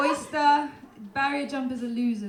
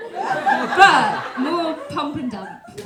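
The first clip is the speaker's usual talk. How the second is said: slow punchline; 4 semitones higher, 8 dB louder, much slower than usual. Acted. Comic landing a rude joke, slow and laughing. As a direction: drag it out, laughing.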